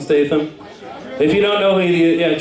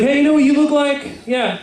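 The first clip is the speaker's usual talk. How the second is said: teasing stranger, pitched way up; street guy's mock compliment; 8 semitones higher than usual. Stranger on the street teasing the comedian, high mock heckle. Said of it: "He's like".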